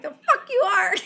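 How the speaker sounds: high, loud retort breaking into laughter; laughing mock outrage. Retelling his refusal of her excuse, laughing outrage. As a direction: imitate an indignant comeback while cracking up.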